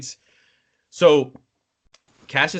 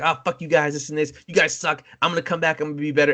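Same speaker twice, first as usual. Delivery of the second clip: lightly voiced sore-loser kid, raised pitch; high-pitched squeaker; 5 semitones higher, faster than usual. Losing kid cursing the winning players, mock petulant. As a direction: imitate a little kid's petulant gamer rant. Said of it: "With very high-pitched voice".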